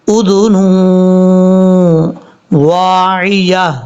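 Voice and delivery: أُذُنٌ nasalized and held into وَاعِيَةٌ; slow tajweed recitation; ghunna into waw. Shown as a demonstration. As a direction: hum the nun into the waw. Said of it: "نون کو واو سے ملاتے ہوئے".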